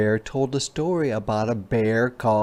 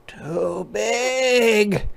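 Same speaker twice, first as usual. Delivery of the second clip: cub voice, much higher, whiny; complaining little cub; 10 semitones higher than usual. Acted. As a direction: complain like a small child. Sam complaining about spoon.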